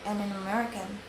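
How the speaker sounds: normal talking voice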